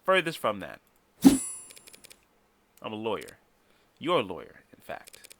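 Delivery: ordinary talk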